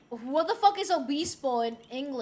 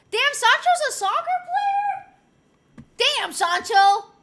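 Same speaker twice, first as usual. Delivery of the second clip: high, slow, drawn-out disbelief; amazed; 10 semitones higher, 7 dB louder, much slower than usual. Real, not acted. Streamer amazed the namesake is a footballer, drawn out. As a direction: stretch it out in astonishment.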